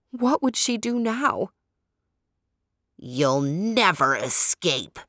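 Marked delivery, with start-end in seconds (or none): 3.0-5.0 low, slow, menacing porcupine voice; awful distant voice. A narrator's reading. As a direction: drag it out, deep and threatening. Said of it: "a distant yet awful voice above".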